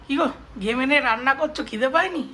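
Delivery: high, honeyed flattery; sweet buttering up. Acted. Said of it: "একটু buttering করে আসি".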